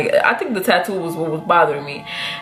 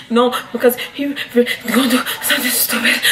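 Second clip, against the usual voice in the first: higher, broken fake sobbing; mock crying; 5 semitones higher than usual. Student protesting to the counselor, mock crying. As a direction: choke it out as exaggerated blubbering. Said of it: "cried like that".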